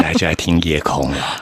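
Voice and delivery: low, lightly sultry radio voice; sultry late-night voice. Pretend. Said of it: "夜空是不是需要这种声音".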